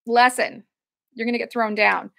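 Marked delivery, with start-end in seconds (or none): none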